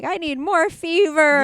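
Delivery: high, loud mock plea; mock pleading demand. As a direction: cry it out like an absurd craving. Mock demand of a feverish person.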